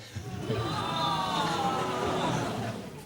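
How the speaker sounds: real burst of high laughter. Real, not acted. Laughing at the paying couple story.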